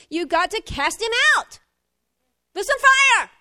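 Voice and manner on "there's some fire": high, loud preaching burst; preacher fires up the church, shouting it out; fiery shout. Real, not acted. Preacher rousing the congregation with a high shout.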